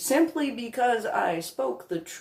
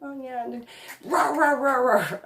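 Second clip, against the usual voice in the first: mock ranting; 5 semitones higher than usual. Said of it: "And so it was just".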